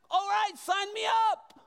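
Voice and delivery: mock eagerness. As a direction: shout it like a naive volunteer. Sarcastic eager disciple.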